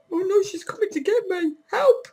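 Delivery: high hammed-up cry for help; mock panic. Mock fear of someone coming.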